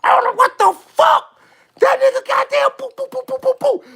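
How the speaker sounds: high-pitched, hyped-up disbelief; high excited voice with mimicked game noises; amazed outburst. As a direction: squeal it out in astonishment. Acting out amazement at the game play.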